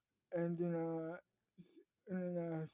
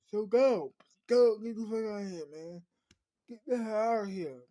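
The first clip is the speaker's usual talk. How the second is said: loud, raised-voice dismissal; urgent ordering out; 3 semitones higher, 7 dB louder than usual. Acted. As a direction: send them away forcefully, pleading impatience creeping in. Character urgently orders visitors to leave.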